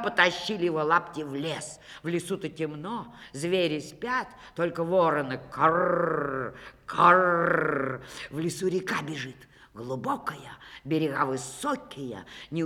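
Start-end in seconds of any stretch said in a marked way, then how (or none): 5.5-7.6 slow croaky caw; crow caw. A narrator's reading. Crows cawing in the dark forest.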